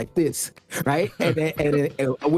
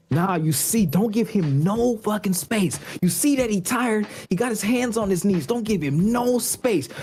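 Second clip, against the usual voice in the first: Andre Iguodala, upset; 7 semitones higher than usual. Retold or imitated. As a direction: imitate a veteran hammering instructions into a teammate. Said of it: "he's so upset with Andrew Wiggins".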